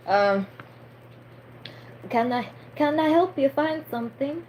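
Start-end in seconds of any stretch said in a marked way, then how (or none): 2.1-4.4 higher-pitched try at a Romanian accent; amateur Transylvanian or Romanian voice; attempted Transylvanian accent. Shown as a demonstration. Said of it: "I should try doing a Transylvanian accent".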